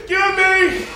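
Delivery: high, pushy put-on voice; mock cutting line. Dad faking to jump the Disneyland line, high demanding. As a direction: grab your way to the front.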